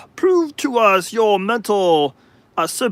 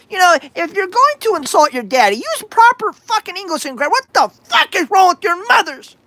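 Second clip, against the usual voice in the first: mock scolding; 9 semitones higher than usual. Scolding the audience.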